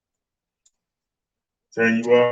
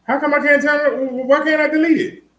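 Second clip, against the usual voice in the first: frustrated; 8 semitones higher than usual. Real, not acted. Frustrated with the phone.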